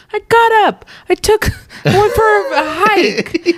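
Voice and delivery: very high mock-chirpy voice; teasing imitation. Friend teasing Michael about his day off, high mock voice. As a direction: squeak it out, drawing out the words. Mimics friend's proud morning report.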